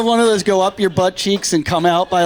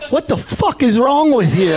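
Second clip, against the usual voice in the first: annoyed guy; 6 semitones higher than usual. Retold or imitated. Bathroom guy voiced angrily.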